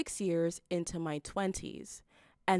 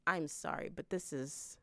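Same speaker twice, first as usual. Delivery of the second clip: ordinary talk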